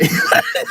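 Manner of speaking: sudden high-pitched laugh; quick breathy burst of laughter. Real, not acted. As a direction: crack up mid-sentence. Cracks up mid-example.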